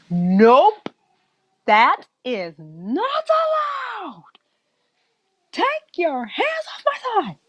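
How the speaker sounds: high-pitched, protective mom outburst; indignant mother. Acted. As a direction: drag out the forbidding, then shoot up high. Mother steps in against villain.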